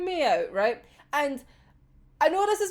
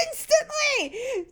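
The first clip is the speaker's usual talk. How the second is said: laughing; 10 semitones higher, slower than usual. Real, not acted. Reacting to the comic.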